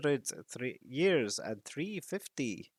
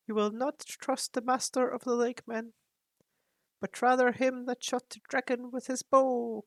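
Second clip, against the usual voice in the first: higher, grave raven voice; counselling raven; 13 semitones higher, 3 dB louder than usual. Acted. Raven Roak counsels Thorin about coming danger. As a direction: warn him like an ancient advisor.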